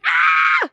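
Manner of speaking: sudden scream at bugs; real shriek of alarm; startled scream. Real, not acted.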